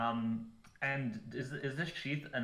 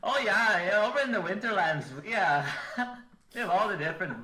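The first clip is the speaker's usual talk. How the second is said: put-on loud, high comic northern accent with an eh; 8 semitones higher, 9 dB louder than usual. Player shows off the character's Winterlands accent, loud and high. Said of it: "I'll have an accent this round".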